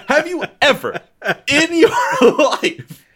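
laughing while talking, pitch high. Real, not acted. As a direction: giggle through the words. Laughing through a mattress joke.

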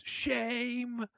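high, drawn-out mock cry; public shaming. Cosplay organizer shaming Adam, high stretched cry. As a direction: imitate a shaming call across the hall. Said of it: "she goes".

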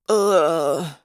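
groggy, pained "ugh"; drawn-out moan on coming round. A narrator's reading. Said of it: "the human moaned".

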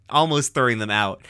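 normal talking voice

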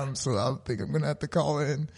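faint and feeble; weak sick voice. Retold or imitated. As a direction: sound too ill to work. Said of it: "that's how I was talking".

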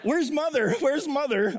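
put-on high, plaintive child's cry; little fawn voice. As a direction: voice a lost little fawn calling. Quoting Bambi to toddler.

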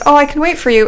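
eager guy voice. Retold or imitated. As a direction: play the overeager guy offering to wait. Retold date scene, eager guy.

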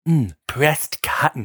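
slow and soft, relishing the scent; mock-connoisseur murmur; slow savoring. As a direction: sniff then savor each word. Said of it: "sniffs again and goes".

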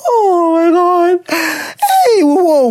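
laughing shriek. Real, not acted. Reacting to blowjob letter.

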